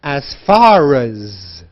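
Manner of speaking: "As far as" run together, slowly drawn out; linked words. Shown as a demonstration. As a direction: link "far" into "as" and stretch it. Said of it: "We link the words together".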